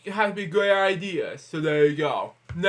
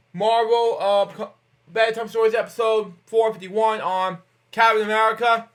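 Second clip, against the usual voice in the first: upbeat host outro, a bit louder and higher; show sign-off; 5 semitones higher, 5 dB louder than usual. Acted. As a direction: wrap up the episode like a kid show host.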